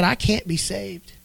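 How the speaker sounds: soft, slow, faraway despair; hopeless hush. Retold or imitated. Dying girl tells her father she is lost, soft slow despair. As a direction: let it fall away, resigned.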